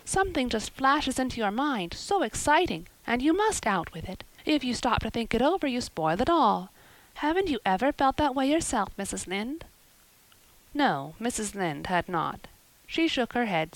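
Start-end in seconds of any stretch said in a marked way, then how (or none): 0.1-9.7 a hint of a dramatic young girl, higher; girl pouring out her woes; lightly voiced eager girl. Anne asking Mrs Lynde why, eager and higher.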